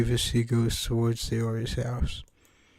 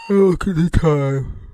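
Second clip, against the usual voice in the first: yawning; 5 semitones higher, 4 dB louder than usual. Acted. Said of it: "and while yawning".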